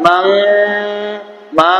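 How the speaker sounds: মাং with a slight hidden nasal, then held hum; ikhfa, then ghunna. Shown as a demonstration. Teacher showing students ikhfa before ghunna on মাং, held nasal hum. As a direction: draw out মাং, easing into a long ghunna. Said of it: "অল্প ইখফা হবে, তারপরে গুন্না".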